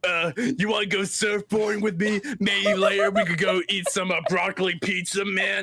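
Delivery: high laid-back surfer-dude voice; California surfer dude. Shown as a demonstration. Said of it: "more of a California voice".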